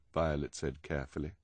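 ordinary delivery

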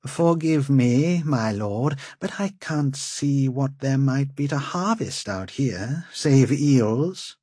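fawning, overly polite servant; oily politeness. A narrator's reading. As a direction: grovel sweetly to the lord. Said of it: "Gint said obsequiously".

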